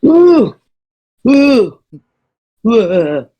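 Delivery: loud, high sarcastic "woo"; mock cheer. Real, not acted. Player mock cheering at disgust.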